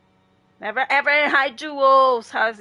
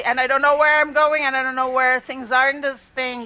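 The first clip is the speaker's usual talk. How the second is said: loud, excited flustered rambling; noisy; 3 semitones higher, 9 dB louder than usual. Real, not acted. Streamer flustered on the new map, loud. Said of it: "I'm noisy".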